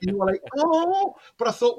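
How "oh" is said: high, loud mock gasp of awe; wowed, pitched right up; amazed reaction. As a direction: imitate an impressed audience going "oh". Imitating the girls' amazed reaction.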